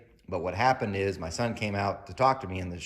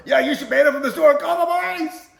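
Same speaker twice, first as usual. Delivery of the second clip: much higher, screaming angry mom; furious shout; 10 dB louder than usual. Retold or imitated. Mom demanding the manager call police, furious shout. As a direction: yell it for the whole store.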